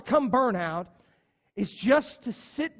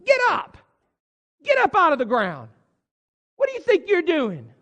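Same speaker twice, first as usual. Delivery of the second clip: loud, high, incredulous telling-off; a friend yelling at Elijah to get up; scolding friend; 6 semitones higher, 7 dB louder than usual. Acted. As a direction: shout him out of his self-pity. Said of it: "we would have said".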